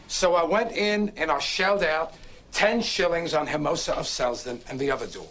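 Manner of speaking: usual speaking voice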